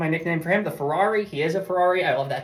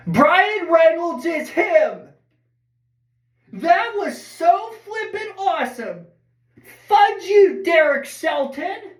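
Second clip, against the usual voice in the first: booming, drawn-out sports announcer call, much higher; announcer style; 11 semitones higher, slower than usual. Pretend. Fan on camera calling the win in an announcer voice. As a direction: belt it out like a play-by-play announcer. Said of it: "I'm gonna do it announcer style".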